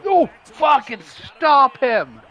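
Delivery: loud, slow frustrated yell; real frustration, hammering every word; exasperated yell. Real, not acted. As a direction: punch out each word in exasperation. Reacting to losing in the game.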